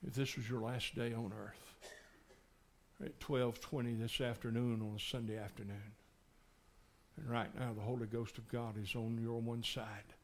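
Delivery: low, soft, intimate appeal; soft altar call. Acted. Preacher pleads softly with listeners to be saved. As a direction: draw them in gently.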